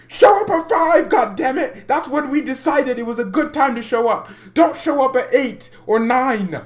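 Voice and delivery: loud, high, heated outburst; exasperated shouting. Real, not acted. Streamer scolding guests who show up late, exasperated shouting. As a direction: yell it at full exasperation, pitch way up.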